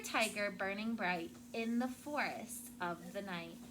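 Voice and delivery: ordinary talk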